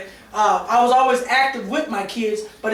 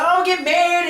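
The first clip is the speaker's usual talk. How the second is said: put-on higher, dreamy child voice; lightly playing a little girl at her dollhouse; little girl dreaming; 9 semitones higher, 3 dB louder than usual.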